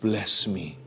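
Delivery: soft, low, humble asking; humble plea. Acted. Jacob humbly asks the angel for blessing, softly. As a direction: ask humbly, almost under the breath. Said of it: "Dan dengan rendah hati dia berkata".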